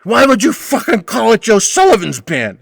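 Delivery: slow, put-on offended old man; hammed-up huffy regular insisting it's his son's band; mock outraged old man, slowed down; indignant Butch impression. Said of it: "immediately Butch is like".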